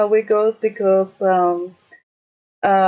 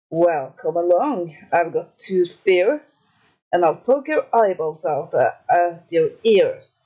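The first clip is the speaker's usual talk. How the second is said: hoarse voice; 3 semitones lower than usual. Acted. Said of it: "ugly hoarse voice".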